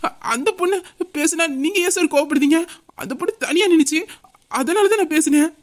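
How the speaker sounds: high-pitched defensive protest; nervous excuse. Acted. Cornered man excuses himself to her partner, nervous.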